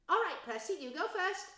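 high, loud mum giving orders; bossy mother. Acted. Mother telling Percy to go first, high and loud. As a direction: send the boy off briskly.

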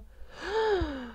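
high drawn-in gasp; gasp of realization. Real, not acted. Fan realizing it would be Armand, high gasp. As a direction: gasp high as it dawns on you.

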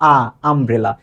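আ sounded alone, then the whole word আমব্রেলা; isolated vowel onset. Shown as a demonstration. Teacher shows learners the first sound, আ isolated before আমব্রেলা. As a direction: separate the open "aa" of আ before saying আমব্রেলা. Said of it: "শুরুতে আসে কি আ".

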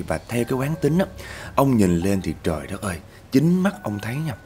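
usual speaking voice

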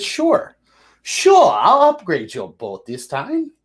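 high, swooping "sure", then drawn out and slow; mock obliging superior. Navy brass answers begging crew about the boat upgrade, mock obliging. Voicing superiors granting the request.

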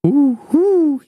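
high loud owl hooting; frightened owl hoots. A narrator's reading. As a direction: hoot out into the dark. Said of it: "he called".

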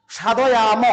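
সাধয়াম spoken slowly and clearly, each syllable sha-dho-yam separated; careful pronunciation. Shown as a demonstration. Teacher showing students how to pronounce সাধয়াম, carefully. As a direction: sound out সাধয়াম deliberately for students to copy. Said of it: "এটা উচ্চারণে হবে সাধয়াম".

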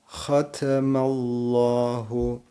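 Hatemallahu with a throaty rasped h, slow; slow chant, rasped kh; raspy ha. Shown as a demonstration. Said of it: "Bakın ha hırıltılı dikkat edin".

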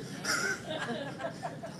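airy, high chuckle; soft high laugh. Real, not acted. Preacher to congregation after wife quote, airy chuckle. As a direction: let out a breathy chuckle.

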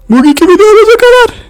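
high, loud cartoon sign-off; a toy shouting subscribe; shrill puppet call; high squeaky outro.

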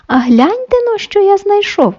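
high-pitched, eager call to come and look. A narrator's reading. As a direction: call them over to see a find. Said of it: "став гукати півник". (гукає високим голосом)

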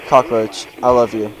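ordinary talk, nothing marked

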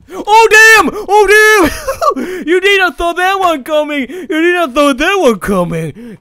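high, loud gloating yell; triumphant shout. Real, not acted. Gamer ambushing the owner, loud triumph. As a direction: roar in triumph.

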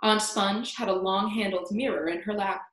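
plain reading voice

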